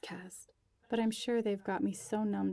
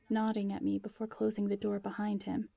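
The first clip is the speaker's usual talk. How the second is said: usual speaking voice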